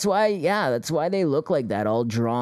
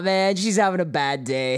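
normal talking voice

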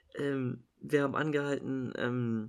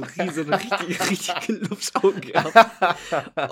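laughing; 6 semitones higher, 9 dB louder, slower than usual. Real, not acted. Describing kid's huge eyes.

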